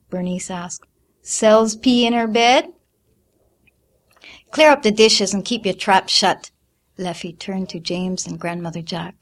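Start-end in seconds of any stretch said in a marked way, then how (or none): 1.2-2.7 slow, loud teasing sneer; mocking. A narrator's reading. Older sister mocking Celestine, sneering. 4.5-6.5 loud sharp scolding. A narrator's reading. Mother scolding older daughter, sharp.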